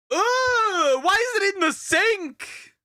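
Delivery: high, horrified squeal of disgust; disgusted shriek. Real, not acted. Shrieking in disgust at the sink. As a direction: recoil in horror at the sink.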